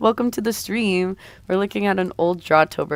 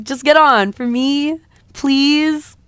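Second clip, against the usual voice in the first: high, coaxing plea; wheedling, higher-pitched request; pleading; 7 semitones higher, 4 dB louder, slower than usual. Acted. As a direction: beg sweetly with a drawn-out please. Acted coaxing plea to board broom.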